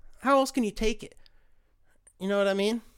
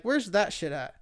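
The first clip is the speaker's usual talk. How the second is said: ordinary delivery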